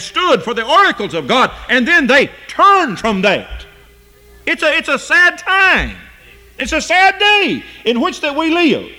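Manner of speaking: fervent sermon delivery; impassioned preaching. Real, not acted. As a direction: preach it out, stretching the key words high. Preaches at full force.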